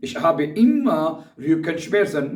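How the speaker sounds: very slow, every German word spelled out for learners; slow dictation. Shown as a demonstration. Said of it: "तो आप कह सकते हैं".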